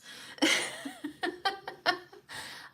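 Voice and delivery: high laugh after a joke; teary laugh. Real, not acted. Vlogger laughing that tears will smear her makeup.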